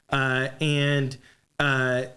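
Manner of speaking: ordinary talk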